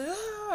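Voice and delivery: high strained frustrated ah; mock frustrated outburst cry. Shown as a demonstration. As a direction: let out a short cry of frustration. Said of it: "and you're just".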